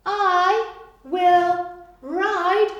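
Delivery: very slow, high and loud; slow reveal. Shown as a demonstration. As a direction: drag out the answer for children. Teacher reveals answer.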